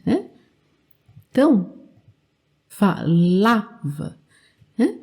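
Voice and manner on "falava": "falava" very slowly, LA stretched out; fa-LAAA-va, slow and lower, in "falava"; stress on LA. Shown as a demonstration. Teacher showing learners the strong syllable, stress on LA. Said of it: "aqui é mais forte".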